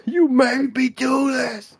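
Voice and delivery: high, slow, hammy accusation; mock accusing. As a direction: blame her in a mock-tragic voice. Mock blaming the dead lover.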